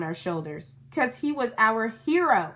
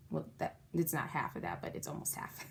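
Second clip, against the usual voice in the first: fast, soft correction; hushed self-correction; whispered aside; 5 semitones lower, 12 dB quieter, faster than usual. Real, not acted. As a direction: mutter the correction.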